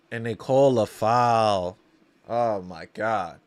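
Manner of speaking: slow, low groan of disbelief; deflated fan's lament; dismayed. Real, not acted. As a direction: let it sink, drawn out and low. Dismay at a foul call.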